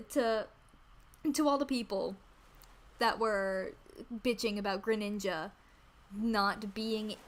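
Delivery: ordinary talk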